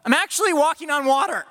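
high, delighted cry of wonder; giddy disbelief; excited Peter. Acted. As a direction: marvel at walking on water. Peter voiced, excited on water.

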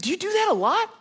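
very high imitation of an awed newcomer; squeaky, wide-eyed question; mock wonder. Awed young man asking the praying pastor, comically high wonder.

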